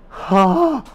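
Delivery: loud, high gasp of shock. Retold or imitated. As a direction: gasp sharply, re-enacting the shock. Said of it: "That was my face when it happened".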